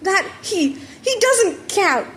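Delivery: high, slow, flustered stammer; sputtering. Acted. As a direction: trip over the words, caught out. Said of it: "Bakugo sputtered".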